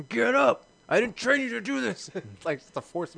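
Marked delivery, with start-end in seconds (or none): 0.1-2.1 loud and high, barking an order; yelling mom. Acted. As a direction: shout like a drill sergeant mom. Voicing Sarah Connor yelling.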